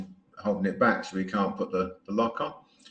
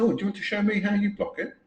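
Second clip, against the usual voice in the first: mock innocent offer; 10 semitones higher, faster than usual. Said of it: "I said".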